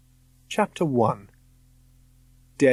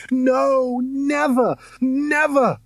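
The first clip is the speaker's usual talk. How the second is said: put-on high frightened boy's cry; terrified scream; 14 semitones higher, much slower than usual. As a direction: scream in terror. Boy screams in nightmare.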